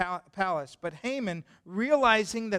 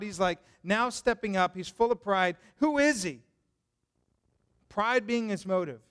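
ordinary delivery

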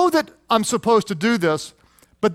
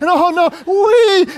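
put-on much higher, slow, louder childlike oh no sliding into wee; fear into glee; 10 semitones higher, 5 dB louder, much slower than usual. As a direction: stretch the thrill of scared delight. Sermon mimics fear turning glee.

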